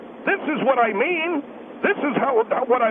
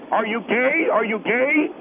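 normal talking voice

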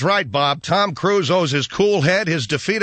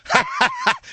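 high giggle. Real, not acted. Host laughs at own joke.

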